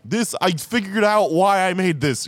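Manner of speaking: high, laughing impression of a creator explaining; a lightly voiced, high director; mock creator quote. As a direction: pitch it up like an exasperated creator spelling it out. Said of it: "The creators of these things are saying".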